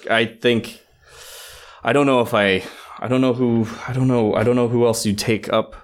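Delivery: usual speaking voice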